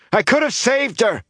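very high, anguished shout; distraught youthful yell; calling after her. A narrator's reading. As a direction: shout it desperately after someone leaving. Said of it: "Aram called after her".

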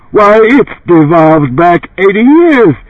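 high, slow brassy exclamation; brassy bellowing housewife. A narrator's reading. Housewife shocked at her old refrigerator, brassy bellow. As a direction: exclaim it big for the advert. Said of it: "In a brassy voice she bellowed".

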